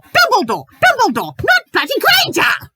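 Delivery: high, loud outburst; furious yelling. Real, not acted. Reviewer raging at the fanfic's mistake, furious yell. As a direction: scream the name in frustration. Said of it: "okay, I need to breathe".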